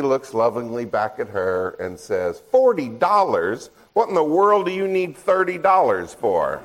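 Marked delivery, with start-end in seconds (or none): 2.5-6.6 higher, outraged "forty dollars?" as the joking dad; mock outraged dad. Dad to daughter asking money, mock outraged. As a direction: play the stingy dad, shocked at the price.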